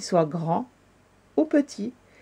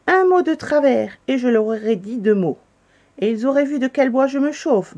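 lightly growled boast, higher and louder; a hint of a blustering animal; grumbling bear; 5 semitones higher, 5 dB louder than usual. A narrator's reading. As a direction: bluster with raised, forceful voice. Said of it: "lorsque l'ours grogna".

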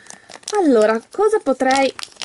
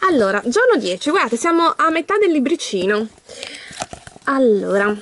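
normal talking voice

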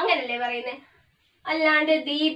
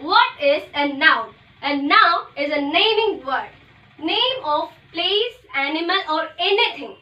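slow dictation; much slower than usual. Shown as a demonstration. Teacher dictating the noun definition to the class, very slowly. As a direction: spell out each word for students to copy. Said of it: "teacher dictating the definition".

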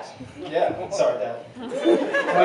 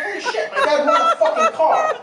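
high, amazed cry; stunned disbelief, laughing; shocked. Retold or imitated. Son hearing his dad won a car, high shocked cry.

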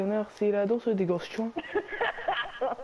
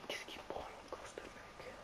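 whispering; 22 dB quieter than usual. Real, not acted. Whispered aside during game.

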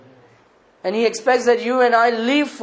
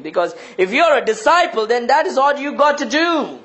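high, driving preacher's voice; impassioned preaching; 5 semitones higher than usual. Acted. Preacher challenging disciples over their excuses, impassioned and high. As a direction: pile up the list with mounting force.

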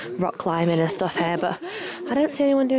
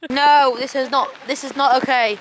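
loud dismayed complaint in rain; loud complaining; 4 semitones higher, 6 dB louder than usual. Real, not acted. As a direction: shout over the downpour. Caught in heavy rain.

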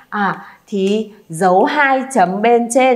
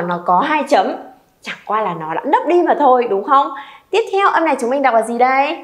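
normal talking voice

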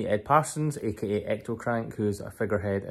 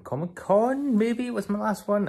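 pitched way up, unsure; high questioning voice; 13 semitones higher than usual. Real, not acted. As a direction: ask it in a high squeak.

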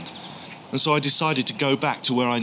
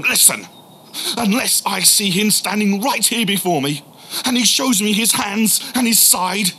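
defiant shout; 6 semitones higher than usual. Retold or imitated. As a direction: throw the challenge at them. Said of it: "And so I screamed at them".

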